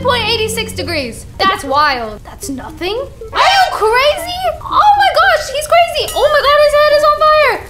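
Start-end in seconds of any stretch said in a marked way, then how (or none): 3.5-7.6 high, loud, fast exclamation; shocked excitement. Real, not acted. Kid seeing father's head burn, high loud panic. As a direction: freak out at the sight.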